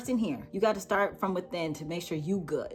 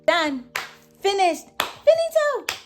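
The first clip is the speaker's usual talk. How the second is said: playful sign-off; 10 semitones higher, 7 dB louder, much slower than usual. Real, not acted.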